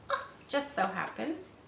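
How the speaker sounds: ordinary delivery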